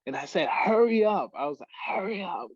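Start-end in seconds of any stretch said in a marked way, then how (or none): 0.5-1.3 high, loud retold cry; urgent breathless plea. Choking trainee to man ahead, urgent mock cry. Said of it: "'cause I couldn't breathe". 1.9-2.5 retold breathless plea. Choking trainee to man ahead, strained retold plea. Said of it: "'cause I couldn't breathe".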